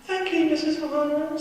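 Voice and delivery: high, bright, polite schoolboy; good boy voice. Acted. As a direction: sweeten it like the teacher's pet. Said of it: "in my good boy voice".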